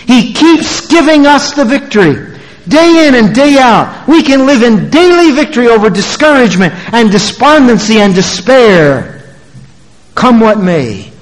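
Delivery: high, forceful pulpit proclamation; triumphant preaching. Real, not acted. Preacher promises congregation daily victory, triumphant preaching. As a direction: ring it out to the whole congregation.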